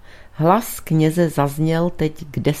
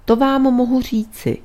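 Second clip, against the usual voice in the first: higher-pitched, prompt answer; ready reply; 8 semitones higher than usual. A narrator's reading. Priest answering his friend's question, readily. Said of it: "řekl kněz pohotově".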